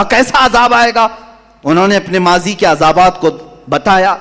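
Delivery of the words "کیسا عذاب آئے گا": higher, scornful taunt; sneering "what punishment?"; mocking challenge. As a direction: throw the question back in mockery. People mock the prophet's warning.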